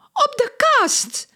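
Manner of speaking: very high, stretched exclamation; high slow excited cry. A narrator's reading. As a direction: squeal it out. Lola pointing at fleas.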